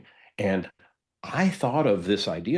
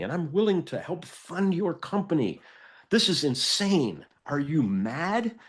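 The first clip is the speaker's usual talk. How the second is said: higher, mock-indignant rant from the investor; retold upset outburst; 7 semitones higher than usual. Investor scolding the secretive founder at the coffee shop, mock outrage. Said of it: "he got really upset".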